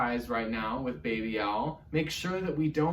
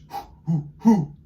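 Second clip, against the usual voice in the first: breathy owl hoo from the diaphragm; owl hoots; 2 semitones higher, 7 dB louder than usual. Shown as a demonstration. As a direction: hoot like Papa Owl on the breath. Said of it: "diaphragm warm up".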